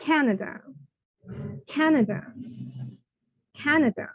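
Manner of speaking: Canada said 'anəda', soft vowels, short A; English Canada, model word. Shown as a demonstration. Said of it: "then we should use".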